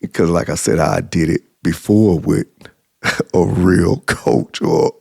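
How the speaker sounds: ordinary talk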